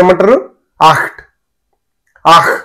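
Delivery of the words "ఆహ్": "ఆహ్" with a rasped kh at the end; "ఆహ్" as if spitting it up; throat-clearing German ach. Shown as a demonstration. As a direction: open wide and hack out "ఆహ్". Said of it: "కక్కాలి ఎదుటని మీద".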